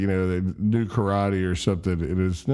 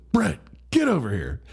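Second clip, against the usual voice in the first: higher, stern mock summons; mad dad; 8 semitones higher than usual. Angry dad summoning his son, stern call. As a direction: call the boy over crossly. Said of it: "he's, like, mad at him".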